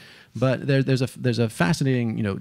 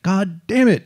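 mock furious outburst, higher and louder; hammed-up Moses blowing up at his people; retold angry outburst; 9 semitones higher, 5 dB louder, slower than usual. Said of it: "and he's pissed".

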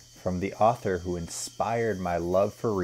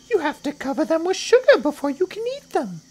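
very high, louder little creature voice; not-so-big crocodile; 9 dB louder than usual. Acted. Not-so-big crocodile arguing children taste bad, high complaining voice. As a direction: complain as a small high-pitched croc.

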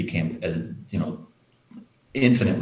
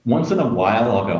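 normal talking voice

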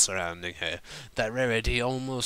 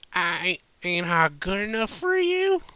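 higher, wounded southern twang; hurt drunk southerner; 10 semitones higher, 5 dB louder than usual. Acted. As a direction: plead like a jilted tipsy country pony. Drunk Applejack hurt, interrupting.

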